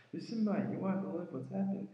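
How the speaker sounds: telling his mate the news, pitched high with excitement; retold excitement. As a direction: burst in with big news.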